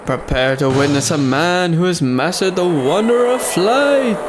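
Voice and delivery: showman's bold call, drawn out; ringmaster announcer. Acted. Ringmaster welcoming the crowd to the show, grand announcer voice. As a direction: belt it out like a circus ringmaster. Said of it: "announcing the circus show".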